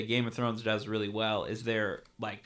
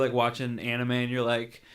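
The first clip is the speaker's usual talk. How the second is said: ordinary delivery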